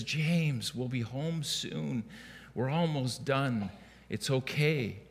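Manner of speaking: soft, slow, soothing hush; quietly calm a wailing toddler. Retold or imitated. Father soothing his crying son in the store, calm and soft. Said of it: "in a calm voice".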